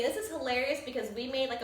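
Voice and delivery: ordinary delivery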